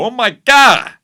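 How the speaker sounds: high, loud exasperated cry; mock outrage. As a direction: cap the rant with an exaggerated yell. End of the acted Prue rant.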